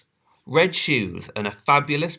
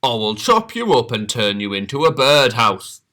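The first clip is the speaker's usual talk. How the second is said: loud booming command; bellow; 2 semitones higher, 6 dB louder than usual. Acted. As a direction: woodcutter threatening. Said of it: "bellowed the woodcutter".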